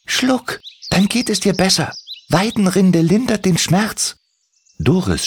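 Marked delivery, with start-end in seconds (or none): 0.1-4.2 höhere, sanft zuredende Koboldstimme; beruhigender Kobold. Acted. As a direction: gut zureden. Timmy beruhigt. (Timmy gibt Doris Weidenrinde, sanft zuredend)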